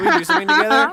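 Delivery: put-on high, loud eager child voice; child character. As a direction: ask brightly as the game's kid. Voices the game child's request.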